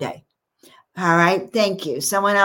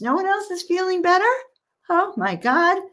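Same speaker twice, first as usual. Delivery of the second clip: mock surprise; 10 semitones higher than usual. Mock dismay at no answers.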